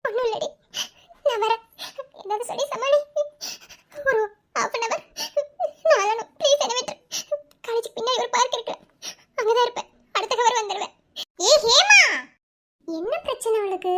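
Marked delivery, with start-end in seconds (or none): 0.1-10.9 tearful, higher-pitched pleading; crying. Acted. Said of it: "அழுகுறேன்னு தெரியுது". 11.5-12.0 high, loud shout of a name; calling out. Acted. Calls out after her friend.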